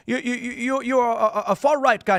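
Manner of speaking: high, flustered stammer of a cornered journalist; hammed-up flustered reporter. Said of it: "the guy's like".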